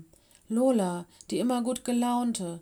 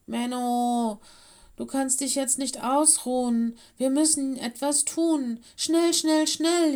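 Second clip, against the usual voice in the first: higher and louder, building to frantic repeated schnell; gasping urgency; 5 semitones higher, 5 dB louder than usual. Acted. Mino gasps at Lenny to act quickly. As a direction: pant it out, pushing the bear to hurry. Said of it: "japste Mino".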